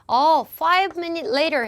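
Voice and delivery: drawn-out casual reply; mock answer read slowly; typical answer. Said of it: "일반적인 답변은".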